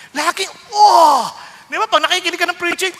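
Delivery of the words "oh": loud, raised "oh!" as if hit; pained outcry. Retold or imitated. Imitating a listener stung by the word, loud outcry. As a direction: yelp as if struck by a blow. Listener struck by hard preaching.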